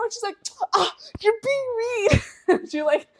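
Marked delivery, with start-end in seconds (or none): 0.7-2.2 high-pitched imitation of hurt mother; hammed-up offended protest; wounded whine. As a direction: complain in a high mock-hurt voice. Mom wounded at being laughed at.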